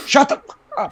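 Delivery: high mock shout through a laugh; retold yell. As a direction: bark it out, half laughing. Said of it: "and yells".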